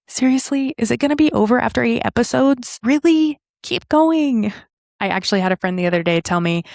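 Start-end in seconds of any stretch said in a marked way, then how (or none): 0.1-4.5 higher, imploring "keep going"; pleading listener. Retold or imitated. Listeners begging host to keep podcast going, pleading. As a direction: beg her not to stop the show. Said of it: "I've had so many of you say".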